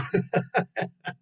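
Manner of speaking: a real, unvoiced laugh pushed out on breath; airless laugh. Real, not acted. Amused by a viewer's comment, with an airless real laugh. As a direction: crack up silently at the joke.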